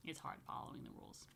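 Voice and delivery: hushed, breathless whisper on the hard part. Acted. To children, whispering that rules get hard. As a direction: drop to a whisper for the hard side.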